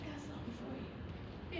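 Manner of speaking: soft secret. Retold or imitated. Friend handing over a kitten, hushed surprise. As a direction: say it softly like a surprise. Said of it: "She's like".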